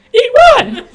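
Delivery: piercing high, loud mock panic; alarmed shriek. Pepper-eater overhearing the secret, imitated alarmed shriek. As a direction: screech it in sudden alarm. Christina startled, whips head over.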